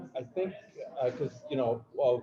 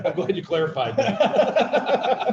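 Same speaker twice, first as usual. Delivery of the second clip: laughing through the words; amused laughing; 2 semitones higher, 3 dB louder, much slower than usual. Real, not acted. Co-host teases speaker over an unfortunate phrase, laughing. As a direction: break up laughing while teasing. Teasing host's slip, laughing.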